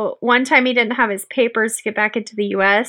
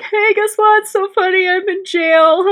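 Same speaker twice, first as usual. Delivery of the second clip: imitating the boyfriend; 8 semitones higher than usual. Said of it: "He's like".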